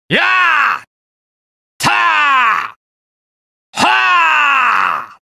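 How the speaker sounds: high-pitched attack yells, growing longer; sharp kiai building into drawn-out yells; battle cries. Acted. Fighter striking harder each blow, shouted attack cries.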